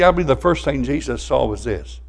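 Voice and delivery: ordinary delivery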